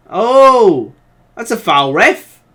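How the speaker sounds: fed-up yell at the referee; shouting at the ref. Real, not acted. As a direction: call out to the referee in protest. Yelling at the referee over foul.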